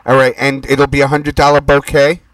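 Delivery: ordinary talk, nothing marked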